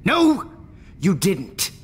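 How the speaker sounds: high, loud snap of no; scornful outburst; sharp loud denial. Acted. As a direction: cut her off hard. Same contradiction after rewind.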